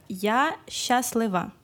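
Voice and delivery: slow, careful feminine form; slow teaching demo. Shown as a demonstration. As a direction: model it slowly for the learner. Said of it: "Si on dit ça pour une fille".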